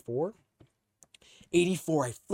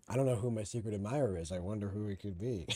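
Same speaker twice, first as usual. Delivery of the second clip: normal talking voice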